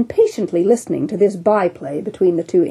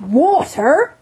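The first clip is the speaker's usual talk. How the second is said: higher, raised cry; cried out; 8 semitones higher than usual. Acted. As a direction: cut in with a hearty cry. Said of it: "cried the captain".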